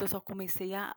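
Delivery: ordinary delivery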